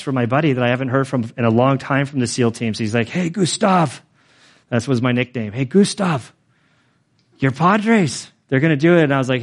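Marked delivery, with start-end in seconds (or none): 3.1-4.0 higher-pitched mock greeting; buddy's hailing call. Old teammate texts a nickname hello, hailing call. 5.5-9.0 raised-pitch retold cheer; buddy's excited hailing. Buddy texts about the Padres, excited hailing.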